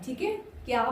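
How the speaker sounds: ordinary delivery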